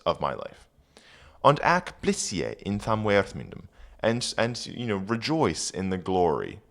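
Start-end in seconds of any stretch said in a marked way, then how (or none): 1.4-3.6 put-on reading Old English aloud; careful Old English recitation. Shown as a demonstration. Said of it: "reading Old English aloud".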